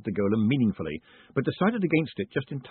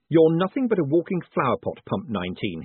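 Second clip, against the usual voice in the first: snapping; 5 semitones higher, 4 dB louder than usual. A narrator's reading. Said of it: "he snapped".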